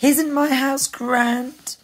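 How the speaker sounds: high, slow boast; drawn-out piggy bragging; proud little pig. Acted. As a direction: show off the new house proudly. Proud pig shows off house.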